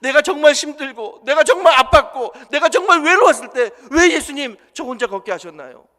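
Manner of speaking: loud, high, anguished demand; angry protest. Acted. A man confronting Jesus over the lone footprints, loud anguished protest. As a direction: cry out the accusation as if betrayed. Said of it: "예수님께 따집니다".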